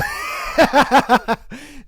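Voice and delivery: a loud burst of laughter. Real, not acted. Podcaster laughing loudly at the story.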